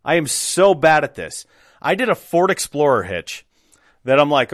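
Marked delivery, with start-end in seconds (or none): none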